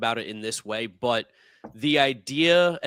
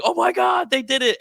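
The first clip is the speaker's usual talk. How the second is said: high, loud mock yell of disbelief; retold screaming, pitched way up and held; imitated excited scream; 13 semitones higher, 7 dB louder than usual. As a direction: yell it high like a friend erupting at huge news. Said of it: "he starts screaming and yelling".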